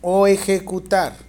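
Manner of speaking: loud, slow dictation in chunks. Real, not acted. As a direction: dictate slowly and clearly, projecting to the class. Teacher dictating exam question to students.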